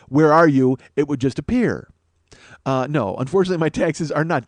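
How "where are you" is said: said out loud. Retold or imitated. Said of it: "she said enough times out loud".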